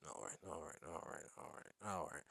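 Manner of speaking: very soft, low self-talk; hushed muttering. Real, not acted. Host settling down after laughing, muttering softly. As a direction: mutter it under your breath, repeated.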